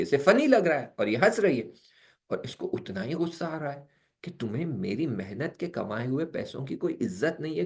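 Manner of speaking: normal talking voice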